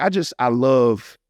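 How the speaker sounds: ordinary talk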